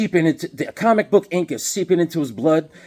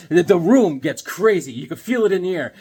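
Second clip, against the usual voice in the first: ordinary talk, nothing marked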